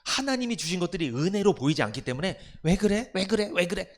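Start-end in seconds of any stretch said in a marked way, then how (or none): 2.6-3.9 high, louder nitpicking whine; nagging faultfinder. Retold or imitated. 불평하는 교인을 흉내 내며 높고 크게 투덜대는 목소리. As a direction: keep nagging at every little fault. Church member nitpicking at everything.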